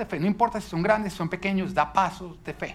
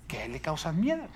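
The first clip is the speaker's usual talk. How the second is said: ordinary talk, nothing marked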